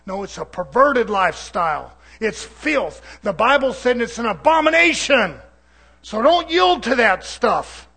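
loud rebuke rising in pitch; forceful preaching. Real, not acted. Preacher answering those who defend the lifestyle, loud rising rebuke. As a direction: thunder the warning from the pulpit.

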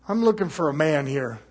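ordinary talk

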